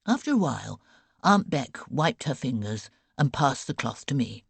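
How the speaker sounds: usual speaking voice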